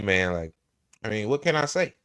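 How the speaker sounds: ordinary talk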